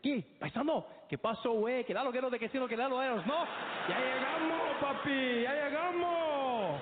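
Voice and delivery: high, loud rapid Mexican street Spanish. Retold or imitated. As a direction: greet a fellow countryman with gusto. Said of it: "He's like".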